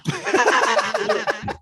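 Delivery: bursting laugh; high laugh. Real, not acted. Laughing at her written goal.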